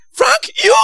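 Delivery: amazed disbelief. Retold or imitated. Preacher recognises Frank, amazed.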